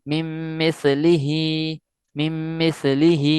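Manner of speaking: من مثلہ slowly, نون merged into the doubled میم; slow recitation. Shown as a demonstration. Teacher demonstrating to students: من مثلہ recited slowly with ghunna. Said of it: "اس میں بھی وہی کہ نون".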